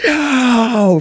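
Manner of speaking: high, stretched-out mock yell; hammed-up amazed whoop; freaking out. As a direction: imitate a crowd losing it. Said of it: "Freaking out".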